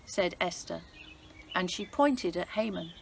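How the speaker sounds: lively, expressive storybook reading; story narration for children. A narrator's reading. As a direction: read it like a bedtime Bible story for kids. Reads Bible story to children.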